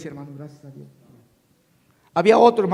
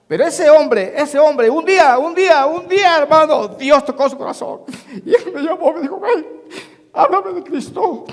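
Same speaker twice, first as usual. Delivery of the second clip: loud, high, fervent sermon delivery; preaching at full force; 6 semitones higher, 6 dB louder than usual. Acted. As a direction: preach it out to the whole church. Preaching builds to climax.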